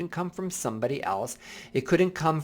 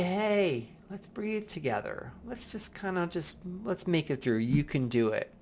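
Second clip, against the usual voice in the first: softer, gentle coaxing; reassuring self-talk; 5 semitones higher, 6 dB quieter than usual. Retold or imitated. Adult self calming the inner kid, soft and reassuring. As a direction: soothe the child gently. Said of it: "you need to say".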